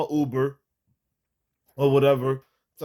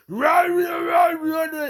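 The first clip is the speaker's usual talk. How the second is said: shrieked rahs, much higher and louder; wild imitated tantrum yell; mock explosion; 4 dB louder, much slower than usual. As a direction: burst into a hammed-up rage, voice cracking high. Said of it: "I didn't fucking explode like".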